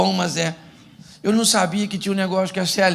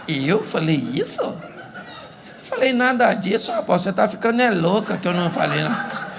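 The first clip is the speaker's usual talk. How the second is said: feigned innocent husband. Retold or imitated. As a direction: act out a husband denying it all. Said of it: "ele olha pra você e diz assim".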